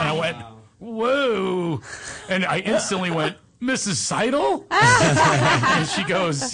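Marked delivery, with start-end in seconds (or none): 0.8-1.8 high, drawn-out mock amazement; retold astonishment. Man meets his old teacher, acted astonishment. 3.6-4.6 high, slow astonished question; incredulous recognition. Retold or imitated. Former pupil recognizes his teacher, acted astonishment.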